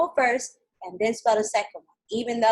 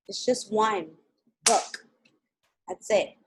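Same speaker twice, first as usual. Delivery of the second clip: ordinary talk, nothing marked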